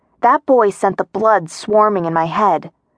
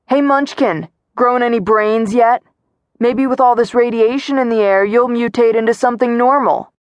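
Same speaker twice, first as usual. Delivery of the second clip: higher-pitched jeering call; taunting; 4 semitones higher than usual. A narrator's reading. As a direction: jeer at them from across a field. Bully taunts across a field.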